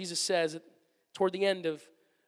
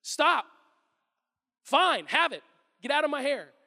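louder, fed-up exasperation; exasperated brush-off; 6 semitones higher, 6 dB louder than usual. Retold or imitated. Imagined annoyed God dismissing a persistent asker, exasperated. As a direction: wave them off like an irritated parent. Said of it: "He's not going".

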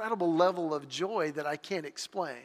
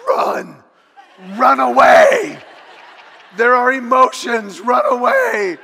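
loud, much higher yelling to flee; panicked inner shout; 5 semitones higher, 13 dB louder, slower than usual. Retold or imitated. His emotional instincts yelling at him to flee, loud panicked shout. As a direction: shout it like every instinct screams to escape. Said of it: "everything in my emotional being is going".

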